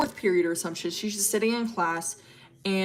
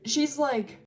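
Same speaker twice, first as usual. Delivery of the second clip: ordinary delivery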